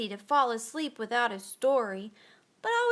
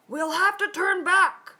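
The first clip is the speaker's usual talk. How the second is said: loud, higher call; 7 semitones higher, 6 dB louder than usual. A narrator's reading. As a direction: call it across the deck. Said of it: "called another".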